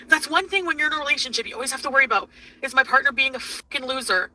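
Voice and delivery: ordinary talk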